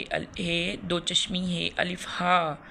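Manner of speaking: ordinary talk, nothing marked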